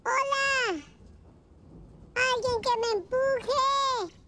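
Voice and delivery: calling out for help. Acted. Child tells señorita Romero a joke, imitating the man's drawn-out call. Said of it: "no dejaba de gritar".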